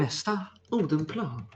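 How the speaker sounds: subway announcer voice. Retold or imitated.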